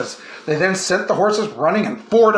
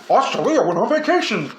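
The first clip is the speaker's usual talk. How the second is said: disguised voice; 3 semitones higher than usual. A narrator's reading. Australia disguised in a mustache.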